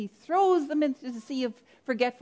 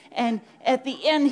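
ordinary talk, nothing marked

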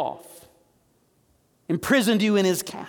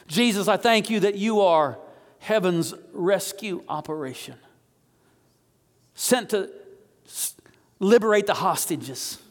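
fervent prayer; 2 semitones higher than usual. Acted. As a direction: call on him like a rescuer. Leads the congregation in prayer.

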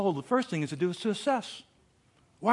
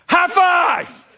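loud, higher victory cry; gleeful high five shout; triumphant shout; 13 semitones higher, 10 dB louder than usual. Retold or imitated. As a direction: celebrate the win with the family. Celebrating with family in van.

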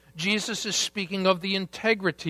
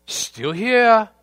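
higher and louder, a touch of wonder; mock amazement; 5 semitones higher, 6 dB louder than usual. Disciples looking up at heaven, lightly amazed. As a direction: voice the disciples finding the world still standing. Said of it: "dramatic pause".

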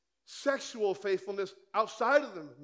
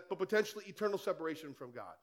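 ordinary talk, nothing marked

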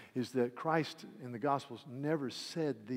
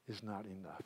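much softer, falling; quiet refrain; 4 semitones lower, 8 dB quieter than usual. Acted. Preacher repeating refrain to church, hushed. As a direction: let it sink in softly.

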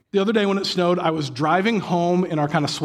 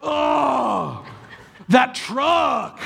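son's deep sighs; 10 semitones higher, much slower than usual. Retold or imitated. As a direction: mimic the little boy's dramatic sigh. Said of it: "letting out these deep sighs".

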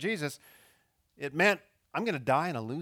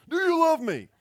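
high, loud halftime pep-talk shout; louder, pumped-up coach shout; hyped coach yell; 10 dB louder than usual. Retold or imitated. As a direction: fire up the team like a coach at halftime. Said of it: "a coach might do at halftime".

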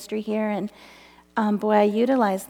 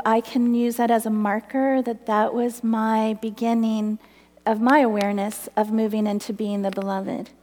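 ordinary talk, nothing marked

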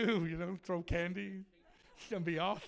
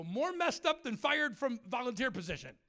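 higher, louder mock outrage; indignant retort; 5 semitones higher, 5 dB louder than usual. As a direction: fire back in disbelief. Acted retort to his buddy.

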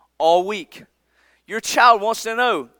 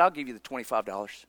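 soft apology; 11 semitones lower, 12 dB quieter, faster than usual. Retold or imitated. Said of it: "He said".

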